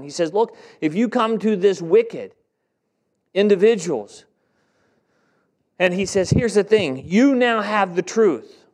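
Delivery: loud, high, driving sermon voice; full-force preaching. Acted. Pastor preaching Ezekiel's warning to the congregation, at full force.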